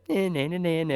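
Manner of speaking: singsong nah nah taunt; childish taunt. Retold or imitated. Mocking a rival, childish singsong. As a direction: tease like a kid in a playground. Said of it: "It's very childish".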